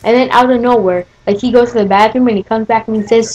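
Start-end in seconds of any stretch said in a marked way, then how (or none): none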